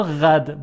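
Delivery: غد opened on a flowing غ; soft ghayn. Shown as a demonstration. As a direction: let the غ in غد run before the vowel. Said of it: "با غین". (تلفظ غد با غین نرم)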